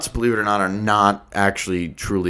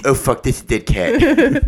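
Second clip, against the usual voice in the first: mock startled Johnny; 4 semitones higher, 4 dB louder than usual. Johnny finds dead cat.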